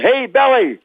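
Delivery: high, playful mock call; teasing. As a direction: imitate co-stars ribbing a colleague. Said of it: "teased him with that name".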